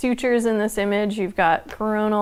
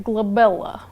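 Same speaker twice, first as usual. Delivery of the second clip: glabella said in a mock Russian accent; lightly voiced Russian accent on glabella. Shown as a demonstration. Streamer answers chat in mock Russian accent. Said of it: "I wanna say it in a Russian accent".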